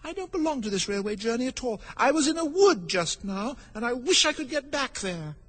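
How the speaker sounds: put-on high-pitched girl's complaint; impatient little Alice; rather impatient. A narrator's reading. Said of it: "Alice said rather impatiently".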